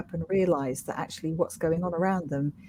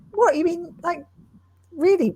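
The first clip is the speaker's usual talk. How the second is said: shocked disbelief; 10 semitones higher, 4 dB louder than usual. Retold or imitated. Mother reacts to her daughter's remark, shocked and high. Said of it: "And I went".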